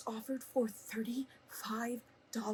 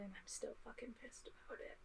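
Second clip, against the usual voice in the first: whispered anger; 2 semitones higher, 14 dB quieter than usual. Real, not acted. Still angry, whispered aside.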